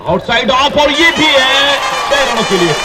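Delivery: high, loud shout for the six; thrilled call of a big hit; excited cricket commentary. Real, not acted.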